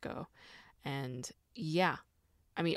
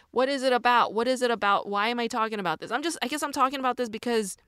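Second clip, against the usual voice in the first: louder, higher, quicker rhetorical questions; animated self-questioning; 6 semitones higher, 7 dB louder, faster than usual. Real, not acted. Asking herself why she's talking about this, loud and quick. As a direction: pepper yourself with questions.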